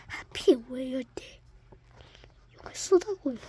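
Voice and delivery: grumbling whisper. Acted. Player grumbling quietly about bad timing. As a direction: mutter it unheard.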